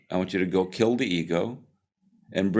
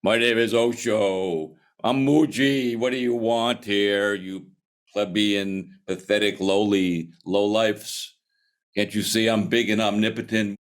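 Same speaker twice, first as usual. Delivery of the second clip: slow, drawn-out pompous proclamation; booming mock guru; 3 semitones higher than usual. Mock guru intimidating lowly seekers, booming and pompous. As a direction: boom it out like an all-powerful wizard.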